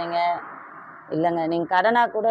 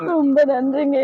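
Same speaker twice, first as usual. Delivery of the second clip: tearful thanks, higher and louder; crying; 9 semitones higher, 6 dB louder than usual. Real, not acted. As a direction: thank him while still weeping. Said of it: "நீங்க அழுவாதீங்கக்கா".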